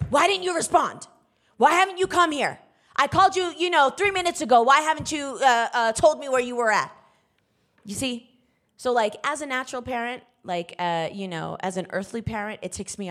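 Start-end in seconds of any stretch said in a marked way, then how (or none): 0.1-6.9 higher and louder annoyed scolding; ticked off. Retold or imitated. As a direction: scold the daughter for not answering. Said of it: "And now I'm ticked off".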